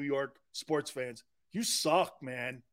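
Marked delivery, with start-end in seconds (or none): none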